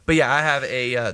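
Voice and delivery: usual speaking voice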